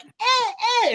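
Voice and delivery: tiny high-pitched creature cooing; small, very high baby noises; Grogu. Retold or imitated. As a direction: squeak it like a little creature. Imitating Grogu's little noises.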